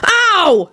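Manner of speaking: high, hollered "ow"; sharp angry pain cry; yelped ow, pitch way up; wrathful yelp of pain. A narrator's reading. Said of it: "Neville hollered wrathfully".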